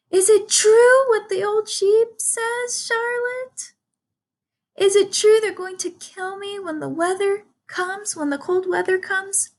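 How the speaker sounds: high squeaky pig voice, anxious pleading; a scared young pig begging the spider for truth; frightened piglet. Acted.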